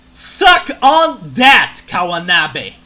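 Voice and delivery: slow, loud triumphant jeer; gloating shout. Real, not acted. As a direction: rub it in, drawn out. Gloating at the painting rival.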